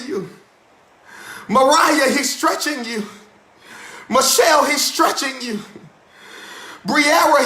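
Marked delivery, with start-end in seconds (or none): none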